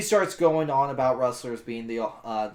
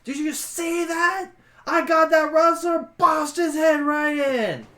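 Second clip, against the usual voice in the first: excited yelling; 7 dB louder than usual. Acted. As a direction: yell it proudly at the top of your pitch. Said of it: "Raz is currently yelling at the Scarecrow".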